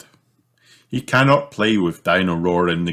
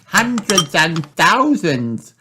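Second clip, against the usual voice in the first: put-on much higher, slow, excited little girl; crying out; 12 semitones higher, 4 dB louder, slower than usual. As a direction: Peppa calls out in delight. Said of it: "cries Peppa".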